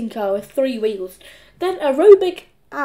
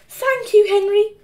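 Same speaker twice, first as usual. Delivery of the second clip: put-on high, louder little-boy thanks; grateful Peter; 10 semitones higher, 6 dB louder than usual. A narrator's reading. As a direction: thank your rescuer in a high voice. Peter thanks his rescuer.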